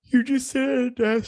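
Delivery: high, slow words stretched through a yawn. Real, not acted. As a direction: talk right through a real yawn. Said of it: "Sorry, I'm yawning".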